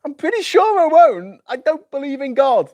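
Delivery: insistent high protest. Pretend. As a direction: insist flatly, ending down. Mock dialogue, insisting.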